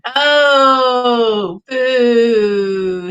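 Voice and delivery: loud, hammed-up boo at the pun; mock boo. Real, not acted. To chat after the scarecrow pun, a loud mock boo. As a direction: heckle the corny punchline. Groaning at the joke punchline.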